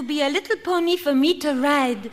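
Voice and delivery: put-on French accent. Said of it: "in a French accent".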